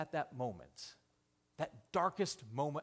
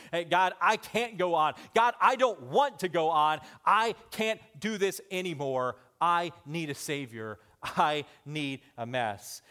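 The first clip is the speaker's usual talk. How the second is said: desperate plea; 5 semitones higher, 10 dB louder than usual. Acted. Voicing a desperate prayer.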